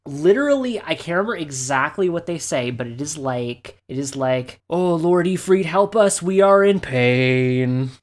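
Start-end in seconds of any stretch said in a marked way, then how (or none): 4.7-7.9 higher, louder, strange creature voice, slowing at the end; pleading lizard creature. Retold or imitated. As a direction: wail a prayer like a bizarre lizard-man. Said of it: "that is, like, almost exactly how it sounds".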